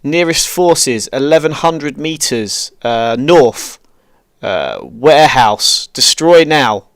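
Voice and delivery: slow, clipped grid readout over radio; calm radio call for fire. Real, not acted. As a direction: read each digit slowly for the gunners.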